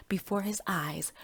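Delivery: plain reading voice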